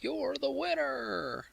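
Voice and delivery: very high, drawn-out, soft mock announcement; mock prize announcement. Host crowning a chat room winner, high drawn-out mock announcement. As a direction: stretch it out high, like a playful prize call.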